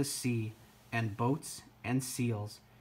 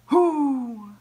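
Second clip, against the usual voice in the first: long, high, loud hoo; loud seal hoot; 11 semitones higher, 9 dB louder than usual. A narrator's reading. Mother seal calling to her baby, loud hoot. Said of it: "they heard a loud".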